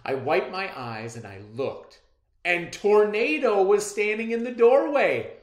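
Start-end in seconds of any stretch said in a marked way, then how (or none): 2.4-5.4 high, loud, building in thrill; child announcing the lost dog in the doorway, thrilled and rising; amazed excitement. A narrator's reading. Build from excited to overjoyed.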